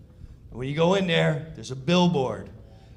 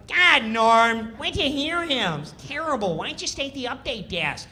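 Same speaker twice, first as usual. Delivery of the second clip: Kattan whine; 7 semitones higher, 3 dB louder than usual. Retold or imitated. Kattan nagging.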